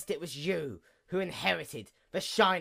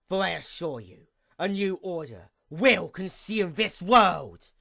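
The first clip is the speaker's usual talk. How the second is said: ordinary talk, nothing marked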